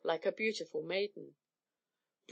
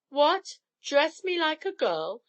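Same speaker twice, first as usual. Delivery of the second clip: an outraged roar, higher and louder; 7 semitones higher, 4 dB louder than usual. Acted. Thor refuses to dress as a bride, roaring. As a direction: bellow at the very idea. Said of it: "he roared".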